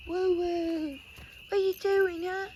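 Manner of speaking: high, playful woo; high teasing call. Real, not acted. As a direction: tease him at the grill. Playful calls at the grill.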